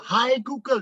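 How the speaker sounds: mock call-out, pitched well up; teriakan tiruan. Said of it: "Saya teriak". (teriak pura-pura, nada tinggi)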